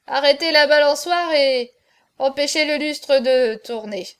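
loud tipsy complaint; fuddled drunk pleading loudly; drunken babble. A narrator's reading. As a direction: babble it as the room spins. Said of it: "balbutiait-il".

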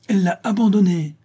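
high, loud contradicting cry; shouted insistence. A narrator's reading. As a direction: shout it back in a quarrel. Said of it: "cria ma jeune maîtresse".

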